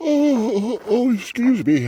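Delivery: high, loud words stretched through a big yawn. Real, not acted. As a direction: let the yawn pull the words up and out. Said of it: "I yawn".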